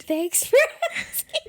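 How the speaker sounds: laughing through the words. Real, not acted. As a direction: break into laughter mid-line. Laughing during check-in.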